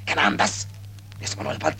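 breath gives out into a whisper; choked, voiceless whisper; gasping whisper. Acted. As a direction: squeeze the words out with no air left. Describes suffocating in the dream.

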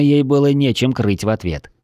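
plain reading voice

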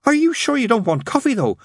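very high, eager nun voice; eager, helpful nun. A narrator's reading.

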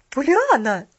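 loud, sharp call; indignant. A narrator's reading. Mrs Carew indignant at Pollyanna. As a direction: cry out indignant. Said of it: "возмутилась миссис Керрю".

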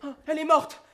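loud high anguished wail; wailing despair. Acted. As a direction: cry out in horror. Lover thinks she is dead.

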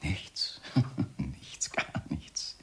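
near-whispered with a soft chuckle; very soft, insinuating whisper; sly hushed denial. Acted. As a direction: whisper it away with a quiet laugh.

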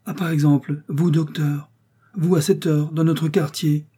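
astonished greeting, pitched high; cry of surprise. A narrator's reading. Workman bumps into the doctor in his neighbourhood, surprised cry. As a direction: exclaim in happy disbelief at meeting them. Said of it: "poussa un cri de surprise".

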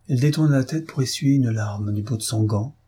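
ordinary delivery

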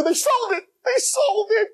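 very high, distraught cry; anguished wail. Acted. Man finding his prize gone, hammed-up wail. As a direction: wail it like all is lost. Imagined disaster, bottle is gone.

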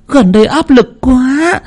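very high, slow tearful wail; tearful distress. Acted. Woman confides her strain to the medium, high and tearful. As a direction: let the strain spill out slowly, near crying.

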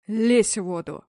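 loud alien monster order; monster command. A narrator's reading. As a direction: roar at her to get in. (чудовище рычит приказ)

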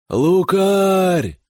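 loud distant shout from the left. A narrator's reading. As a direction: yell out a name. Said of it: "раздался слева крик".

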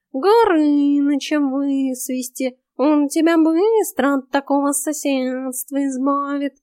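high, slow lazy cat voice; snooty bored cat. Acted. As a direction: drawl it like a smug cat. Cat voice in the story. (высокий ленивый кошачий голос)

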